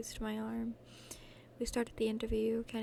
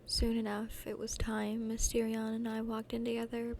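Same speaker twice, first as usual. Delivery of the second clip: usual speaking voice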